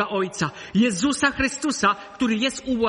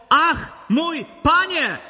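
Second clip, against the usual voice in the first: zawołanie ucznia; 8 semitones higher, 5 dB louder, much slower than usual. Retold or imitated. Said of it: "zawołał".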